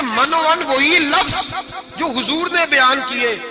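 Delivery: slow emphasis. Acted. Stressing exact same words.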